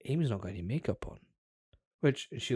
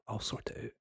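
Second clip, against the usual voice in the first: hushed reassurance; whispered promise to fix it; 8 dB quieter than usual. Retold or imitated. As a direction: whisper it calmly. Reassuring his tired fiancee.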